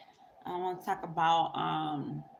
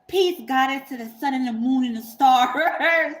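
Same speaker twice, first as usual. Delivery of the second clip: louder, higher imitation of his recited greeting; mock reciting; 4 semitones higher, 6 dB louder than usual. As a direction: recite it like a self-styled divine man, about to laugh.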